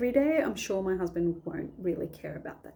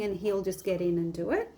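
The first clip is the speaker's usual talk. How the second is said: ordinary talk, nothing marked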